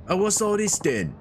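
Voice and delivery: put-on high, bright-eyed girly voice; Yuki voice. Yuki's lines voiced high.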